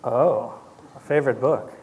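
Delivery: usual speaking voice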